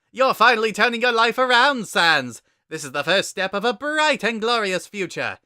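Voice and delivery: very high, loud, gushing brother; loud high proud-brother voice; Papyrus voice. Acted. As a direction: cheer him on at full volume.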